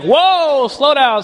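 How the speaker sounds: high, lightly voiced God saying whoa; a playful hint of God pulling him back; God reining him in.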